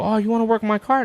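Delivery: high mocking customer voice; mocking asker. Man mimicking people asking him to fix cars, high and mocking. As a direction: toss it up high like a naive customer. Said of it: "when people go".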